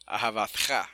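ahabatja with the accent on final "tja"; stress on last syllable. Shown as a demonstration. Teacher demonstrating where the accent falls, final stress. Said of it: "el acento va al final".

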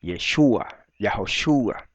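Yeshua and Yahoshua stressed on SHU; low, measured Yeshua, Yahoshua with accent on the U; Hebrew pronunciation. Shown as a demonstration. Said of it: "Lo correcto en hebreo".

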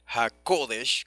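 "HaKodesh" stressed on the O, ha-KO-desh; slow "HaKodesh", leaning on KO. Shown as a demonstration. Teacher showing the accent, "HaKodesh" with a stressed O. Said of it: "con acento en la O".

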